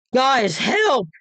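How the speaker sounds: high, urgent shout; yelled for help. A narrator's reading. Chris dragged by spirit, yelling for help. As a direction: call out to your friends for rescue. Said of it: "Chris yelled".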